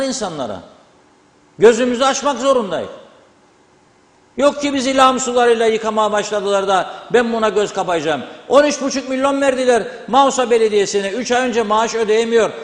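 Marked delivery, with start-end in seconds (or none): none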